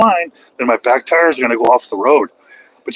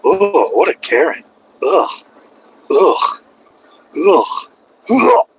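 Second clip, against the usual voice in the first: disgusted onlooker; 3 semitones higher, much slower than usual. Retold or imitated. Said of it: "the rest of the world... goes".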